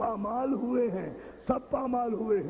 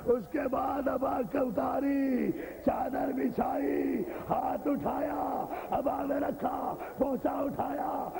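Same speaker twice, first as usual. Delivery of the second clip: high, half-sung lament, slow at the end; preaching at full force; 4 semitones higher than usual. Acted. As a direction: chant each step of the mourning. Chanted narration of the body. (گریہ بھرا نوحہ انداز)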